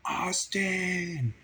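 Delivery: high, loud, drawn-out holler; yelled call for help. Retold or imitated. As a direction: yell the name out to someone nearby. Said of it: "I yelled out".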